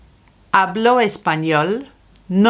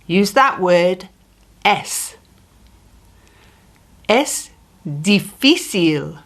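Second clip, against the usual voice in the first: ordinary delivery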